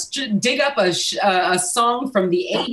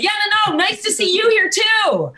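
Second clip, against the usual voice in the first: high raised voice over noise; talking over music; 11 semitones higher, faster than usual. Shown as a demonstration. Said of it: "you end up talking like this".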